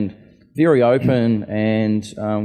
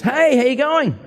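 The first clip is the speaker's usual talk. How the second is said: much higher, bright mock greeting; John cheerfully greeting a visitor, in a much higher imitated voice; cheery greeting; 4 dB louder than usual. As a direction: imitate a chirpy hello, pitched way up.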